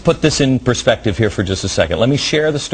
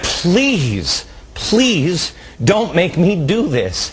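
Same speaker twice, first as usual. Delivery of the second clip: retold plea; 6 semitones higher, much slower than usual. Acts out Jesus pleading in Gethsemane.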